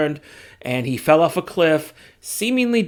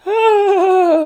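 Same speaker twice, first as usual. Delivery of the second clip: high, loud hammed-up cry as he collapses; over-the-top fainting wail; mock fainting cry; 13 semitones higher, 6 dB louder than usual. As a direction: ham up a dramatic swoon. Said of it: "He pulls a Jean Grey in the nineties X-Men thing".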